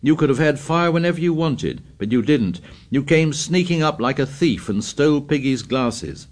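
angry. Acted. Ralph's angry accusation.

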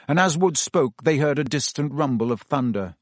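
normal talking voice

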